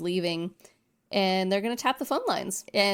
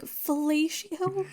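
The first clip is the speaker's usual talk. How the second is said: slow, high puzzled voice; a mock-baffled cop asking about a strange word; baffled question; 9 semitones higher, much slower than usual. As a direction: drag out the word like you can't believe it. Voicing a baffled officer.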